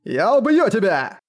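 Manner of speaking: high, drawn-out roar of threat; enraged roar. A narrator's reading. Enraged brute threatens the woman he attacks, roaring. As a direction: roar the threat slowly, pitch flung up. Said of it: "взревел Корд".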